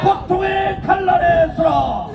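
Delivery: slow, shouted rally chant; roaring rallying speech. Acted. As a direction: drag each word out for the crowd.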